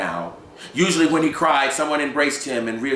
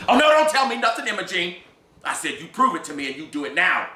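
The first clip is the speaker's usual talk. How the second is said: angry command; 9 semitones higher, 4 dB louder than usual. Acted. Gus orders her.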